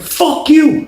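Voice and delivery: high-pitched mock fury; heated comeback in a retold quarrel; retold angry retort. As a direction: imitate him cursing back, high. Retold exchange of curses.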